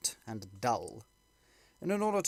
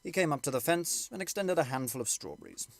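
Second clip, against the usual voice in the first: plain reading voice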